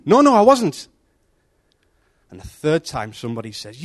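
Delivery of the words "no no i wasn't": high, loud denial; insist, much higher and louder; insistent denial. Acted. Peter denies again.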